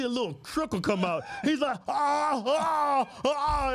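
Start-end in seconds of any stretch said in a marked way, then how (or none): 1.9-3.7 imitating old man's strain. Storyteller imitating the old man at the urinal, mock straining groans.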